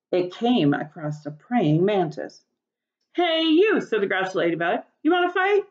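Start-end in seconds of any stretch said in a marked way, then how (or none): 3.1-3.8 loud, high, challenging call. A narrator's reading. Grouchy ladybug calls out. 5.0-5.6 high, pushy taunting challenge; challenging. A narrator's reading. Grouchy ladybug challenges.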